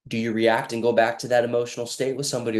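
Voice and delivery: ordinary talk, nothing marked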